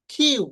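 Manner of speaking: "কিউ" high, with aspirated k; correct letter name. Shown as a demonstration. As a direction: puff of air opening "কিউ". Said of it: "এটা সঠিক উচ্চারণ কি". ("কিউ" খিউ-এর মতো)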